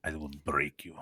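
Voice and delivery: put-on low, menacing Russian accent. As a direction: growl it like a Russian boxer. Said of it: "I can say it with a Russian accent".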